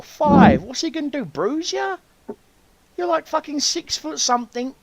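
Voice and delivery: high-pitched incredulous mockery; scoffing dad. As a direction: imitate a dad belittling a big man's complaint. Dad scoffs at man's complaint.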